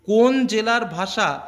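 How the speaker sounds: ordinary delivery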